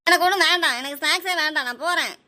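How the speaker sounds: high, loud burst easing off; upset refusal. Acted. Child rejecting leftover snack to mother, upset. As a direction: refuse hotly, then storm away.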